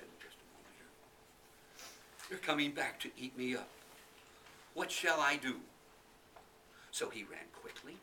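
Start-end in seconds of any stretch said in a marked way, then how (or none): 2.3-5.6 louder, a little higher fretting; a mild panicked little boy; worried boy. Acted. Boy frightened.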